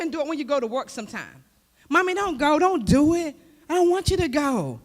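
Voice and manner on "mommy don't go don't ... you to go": put-on high, pleading little child voice. Child begging mother at the door, high and pleading. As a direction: beg mommy not to leave, in a high child's voice.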